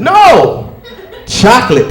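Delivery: sudden high yelled no; comic shout. Acted. Comedian yelling at husbands who ignore wife. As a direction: bark the no at them.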